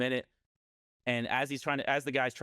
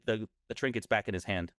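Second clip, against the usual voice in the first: plain reading voice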